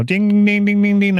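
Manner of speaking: high sing-song ding ding like a casino slot machine; jackpot bell ding. Retold or imitated. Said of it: "like, well, you won, you won the jackpot".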